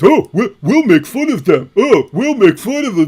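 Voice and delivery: mock them as silly adolescents; childish mocking. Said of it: "you think like little kids".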